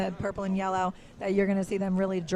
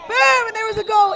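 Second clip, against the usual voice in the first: very high, loud cry of excitement; goal-call shout cutting into an interview; excited shout; 12 semitones higher, 9 dB louder than usual. Real, not acted. Sudden goal during an interview.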